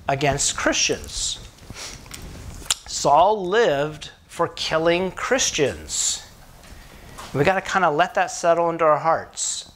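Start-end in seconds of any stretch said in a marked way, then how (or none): 2.9-6.2 slow, weighty statement. Acted. Preacher lets the point settle.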